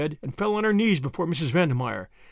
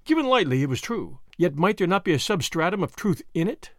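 usual speaking voice

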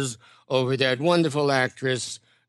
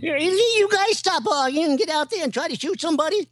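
put-on much higher, cackly old-timer voice; Walter Brennan voice; 14 semitones higher than usual. Comic doing the old sidekick from Rio Lobo, pitched way up. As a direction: squeak it out like a cranky old sidekick.

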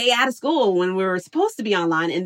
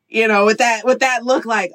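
ordinary talk, nothing marked

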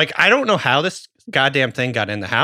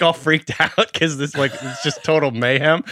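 ordinary delivery

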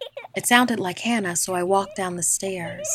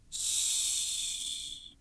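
soft, voiceless hush; long whispered shh; whispered shush; 13 dB quieter than usual. A narrator's reading. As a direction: hush someone under your breath. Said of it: "she whispered".

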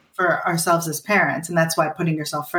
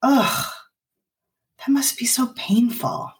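slow, whispered sympathy; empathetic mom; 6 semitones higher, slower than usual. Retold or imitated. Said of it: "imagine a mom who says something like".

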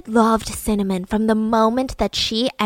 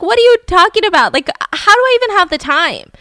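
high, loud, fast protest; acted-out exasperated disbelief; indignant denial; 8 semitones higher, 5 dB louder than usual. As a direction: fire back at an absurd accusation. Wife denies cheating accusation.

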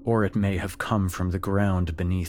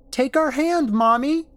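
put-on much higher, loud child crying for mommy; child calling; 7 dB louder than usual. Creature voicing her little son calling for his mother, high and loud. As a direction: call like a small boy. Child voice through possessed Ellen.

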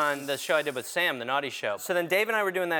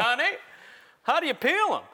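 higher, bewildered husband voice; dumbfounded sitcom husband; 8 semitones higher than usual. Retold or imitated. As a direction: play the clueless eighties husband. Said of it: "like a dumbfounded husband in an eighties sitcom".

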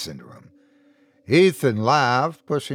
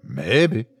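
grinning; 2 semitones lower than usual. A narrator's reading. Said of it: "Ethan grinned in the shifting shadows".

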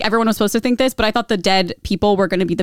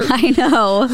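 laughing; 4 semitones higher than usual. Real, not acted. Laughing at the scene.

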